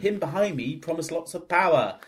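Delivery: hammy villain, high and loud; stilted old movie melodrama. Retold or imitated. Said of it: "with his big hammy acting".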